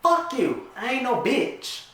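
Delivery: high, loud and outraged; furious retort. Acted. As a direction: spit it back at him. Justice fires back at Lucky.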